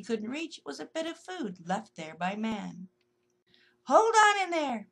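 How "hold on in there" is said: higher, much louder call into the can; calling loudly to the stuck squirrel. Acted. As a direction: shout encouragement. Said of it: "talking loudly to the squirrel".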